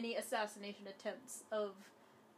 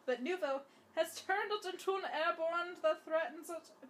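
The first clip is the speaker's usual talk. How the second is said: put-on high, loud silly German accent; excitable mock German accent, pitched up; 7 semitones higher, 5 dB louder than usual. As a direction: keep up the hammed-up German accent, louder.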